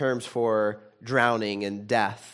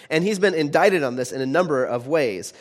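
ordinary delivery

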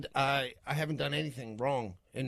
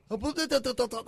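high blah-blah nagging imitation; mock nagging; 8 semitones higher than usual. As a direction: lightly mimic a wife's endless complaining. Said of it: "And it's like".

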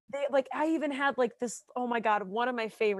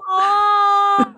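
touched aw; 9 semitones higher, 12 dB louder than usual. Real, not acted. Touched by the child.

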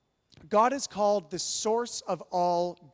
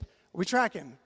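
ordinary talk

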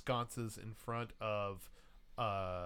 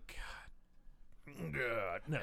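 muted, lightly acted dismay; Steven; 7 dB quieter, much slower than usual. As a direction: groan it under the breath. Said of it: "then you hear Steven go".

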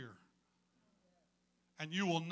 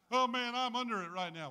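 mock complaint; 6 semitones higher, 7 dB louder than usual. Mock believer complaining of attack.

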